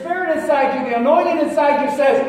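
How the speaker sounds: preaching. Acted. Preacher stirring up the congregation, preaching at full force. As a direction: preach it, climbing toward the shout.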